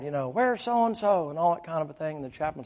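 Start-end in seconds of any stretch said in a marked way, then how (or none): 0.3-1.3 high, drawn-out mock holler; retold holler. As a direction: call it out long and high, without full volume. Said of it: "in there and hollered".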